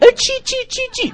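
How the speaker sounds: very high mock squeal to a dog; little-dog baby talk. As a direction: squeak playfully as if calling a tiny dog. Joke reprise of little-dog voice.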